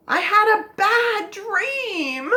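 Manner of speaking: put-on high, slow wail of a frightened child; afraid. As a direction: wail it like a scared kid. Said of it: "they're afraid".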